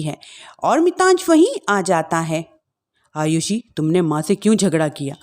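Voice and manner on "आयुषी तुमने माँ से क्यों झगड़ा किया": lower, subdued husband; sad husband voice. Acted. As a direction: voice a hurt grown son. Husband questions wife.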